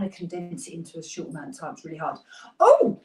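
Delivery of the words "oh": high, loud startled oh; sudden realization. Real, not acted. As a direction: jolt as it comes back to you. Suddenly remembers something.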